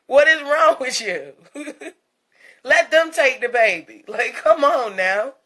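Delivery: ordinary talk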